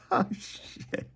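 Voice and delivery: breathless whispered oh no; hushed horror under the breath; a whispered mock groan of realization; whispered dismay. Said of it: "He's like".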